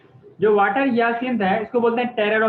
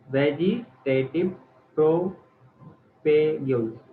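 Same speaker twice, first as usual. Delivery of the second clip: slow, broken into syllables, lower pitch; slow dictation; 6 semitones lower, 3 dB quieter, much slower than usual. Shown as a demonstration. Teacher repeating a term for a student, slow dictation. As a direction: spell it out slowly, piece by piece.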